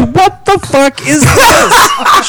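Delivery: high, slow, laughing outrage; mock the wife's disbelief, laughing; outraged mock demand. Telling friends about his wife's restaurant outburst, high laughing outrage. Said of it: "She goes".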